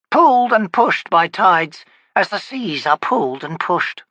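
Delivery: high, loud crone expounding; lecturing witch. Acted. Witch explaining the world to the wizard, loud high crone voice. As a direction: preach it like a know-all hag.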